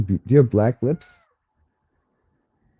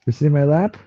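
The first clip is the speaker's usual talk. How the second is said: cooing to the cat; 5 semitones higher, faster than usual. Real, not acted. Cat on her lap.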